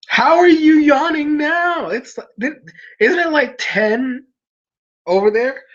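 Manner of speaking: disbelief. Real, not acted. Streamer asking a sleepy viewer, high amused disbelief.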